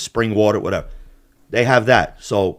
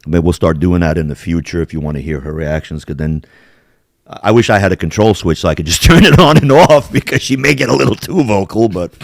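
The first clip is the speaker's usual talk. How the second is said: ordinary talk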